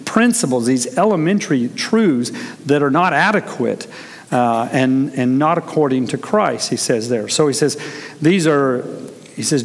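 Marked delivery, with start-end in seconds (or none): none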